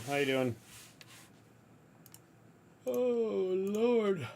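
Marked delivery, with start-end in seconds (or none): 2.9-4.3 exasperated. Real, not acted. Exasperated exclamation on stream.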